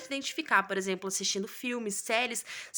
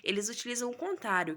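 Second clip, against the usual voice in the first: ordinary delivery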